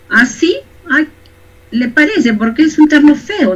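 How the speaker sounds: lightly voiced doubtful listener; mock inner reaction. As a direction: let the voice rise in mock self-doubt. Voicing the listener's inner thought.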